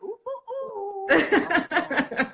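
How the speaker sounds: high, laughing mock "ooh" like a nosy host; teasing interviewer oohs. Mocking how live interviewers tease, with high laughing oohs. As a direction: tease as if fishing for gossip. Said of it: "they're always a bit like".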